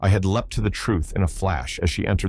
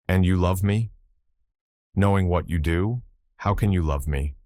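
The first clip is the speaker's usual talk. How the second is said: ordinary delivery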